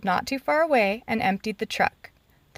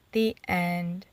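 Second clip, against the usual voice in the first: plain reading voice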